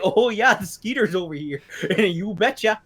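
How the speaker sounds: lightly mock a cheerful Minnesota local; Minnesota accent. Local explaining skeeters at the festival, in a mock Minnesota accent. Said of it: "there were so many accents".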